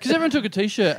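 ordinary talk, nothing marked

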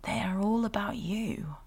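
soft breathy whisper. Acted. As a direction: whisper it close and slow. Whispering to the baby.

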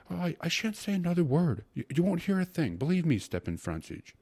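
flustered secretary in a high voice; flustered placating. A narrator's reading. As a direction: hurriedly placate an angry superior. Secretary placating the angry judge.

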